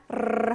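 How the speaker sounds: 르르 as a long tongue-tip trill; 르르 rolled like a purr. Shown as a demonstration. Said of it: "하고 떨어집니다".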